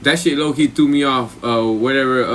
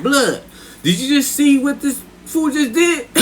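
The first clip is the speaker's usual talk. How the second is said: high, slow, incredulous retelling; mock disbelief; 11 semitones higher than usual. Opponent to his homies about the snapped pinky, mock disbelief. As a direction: drag it out high as a stunned opponent.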